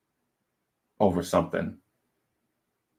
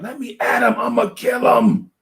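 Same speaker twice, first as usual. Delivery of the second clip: high, loud, raging shout through a cell door; strained high yell of a furious inmate; inmate yelling threats; 3 dB louder than usual. Retold or imitated. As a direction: scream it like a caged man wanting out. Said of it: "he would be, like, shaking his door".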